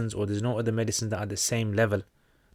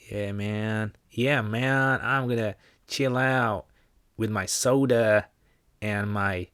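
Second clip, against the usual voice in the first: drawn-out, exaggerated American accent; mock American accent; much slower than usual. Chilling on a Saturday night, mock American accent. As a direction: lay on a slow, stretched American accent. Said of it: "this is how we think you guys sound".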